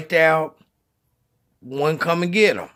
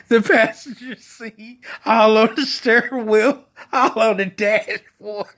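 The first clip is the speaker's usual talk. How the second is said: telling it through real laughter, higher pitched; laughing to tears; 5 semitones higher than usual. Real, not acted. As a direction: barely hold it together from laughing. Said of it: "like, literally crying on".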